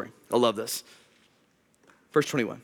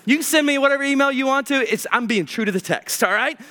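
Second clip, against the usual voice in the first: usual speaking voice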